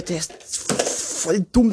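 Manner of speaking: slow drawn-out mock scorn; slowly teasing the little son. Real, not acted. Teasing the toddler.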